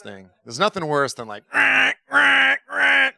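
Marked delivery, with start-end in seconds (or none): 1.5-3.1 loud, much higher blaring "rah, rah"; imitated harsh alarm. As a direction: mimic a jarring alarm buzzer.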